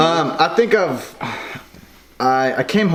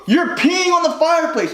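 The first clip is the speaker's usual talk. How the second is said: much higher, incredulous mock brother; brother imitation; 12 semitones higher than usual. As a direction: say it high and disbelieving. Brother points out the fireplace.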